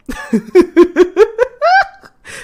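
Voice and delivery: high burst of laughter, real; loud delighted laugh. Real, not acted. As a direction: crack up with glee at the price.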